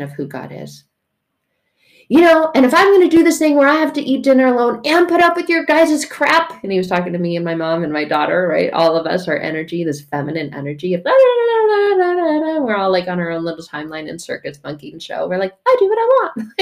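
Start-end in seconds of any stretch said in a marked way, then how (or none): 2.1-6.6 high, loud, fast stepdad tirade; mock angry rant. 11.1-12.6 babbled la-la-la. Retold or imitated. Mimicking scattered feminine energy. 15.7-16.5 very high-pitched bratty mimicry; mock defiance. Mocking the family's attitude.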